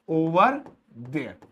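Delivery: teacher spelling out "over there"; split pronunciation. Shown as a demonstration. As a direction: break "over there" into two beats. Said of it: "ऐसे बोलूंगा".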